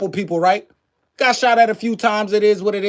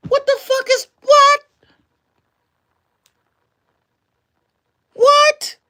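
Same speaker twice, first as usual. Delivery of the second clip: very high-pitched, incredulous squeal; incredulous outburst; 4 dB louder, slower than usual. Real, not acted. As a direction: squeal it in sheer disbelief. Incredulous at others' foolish choices.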